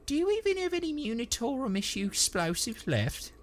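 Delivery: high, curious dialect voice; questioning trooper. A narrator's reading. Trooper asking his mate about explosives, high query. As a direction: ask in a high character voice. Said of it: "asked Nubby".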